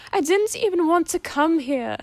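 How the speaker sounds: high, loud tearful wail; upset daughter sobbing out her complaint. Acted. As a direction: cry it out, voice breaking high. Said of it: "Octavia sobbed".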